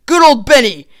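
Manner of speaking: loud comic outburst, high at first; mock telling-off. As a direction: yell down the whiners. Snaps back at the complainers.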